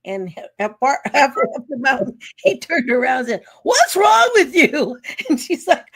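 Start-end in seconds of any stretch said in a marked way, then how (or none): 3.6-5.0 loud, high, slow mock demand; loud, higher, laughing imitation of a puzzled husband; retold husband's outburst. Husband on the mountain to his wife, loud mock demand. Husband snaps on hiking trip.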